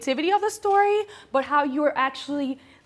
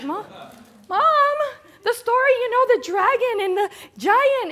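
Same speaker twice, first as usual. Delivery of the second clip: loud, high whine at mom; retold kid's impatient protest, pitched way up; exasperated kid; 9 semitones higher, 4 dB louder than usual. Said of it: "And I'm like".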